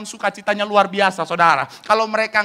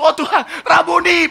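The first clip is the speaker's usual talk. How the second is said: high, eager acted exclamation; excited, raised-pitch calling; overjoyed outcry; 9 semitones higher, 3 dB louder than usual. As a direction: cry out as if rushing to embrace. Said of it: "saudara pengen langsung".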